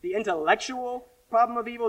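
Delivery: normal talking voice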